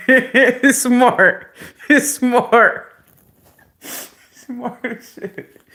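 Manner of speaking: high, loud, cracking up; laughing admiration. Real, not acted. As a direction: squeal it through laughter, tickled by the trick. Laughing at child's trick.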